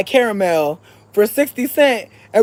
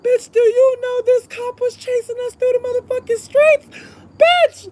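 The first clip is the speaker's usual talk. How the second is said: very high, worked-up storytelling squeal; high-pitched, astonished exclaiming; incredulous squeal. Retold or imitated. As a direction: squeal it in comic outrage. Exclaims over the police chase.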